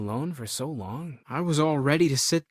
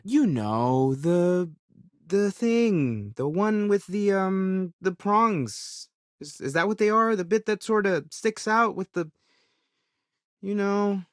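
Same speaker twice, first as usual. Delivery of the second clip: halting and higher, searching for the word; hesitant, pitched-up muddle with fillers; fumbling for words; 4 semitones higher, slower than usual. Acted. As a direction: stall and grope for the name of it. Groping for a forgotten word.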